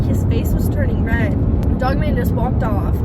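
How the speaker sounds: ordinary talk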